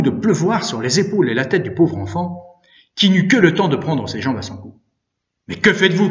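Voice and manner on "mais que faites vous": loud, high protest; indignant raised voice; crying out. A narrator's reading. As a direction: call out to stop him. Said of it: "criai-je bien haut".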